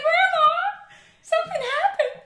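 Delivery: put-on high, loud, panicky child voice; panicked girl. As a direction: cry out to grandma as if dying. Retelling panicked call to grandma.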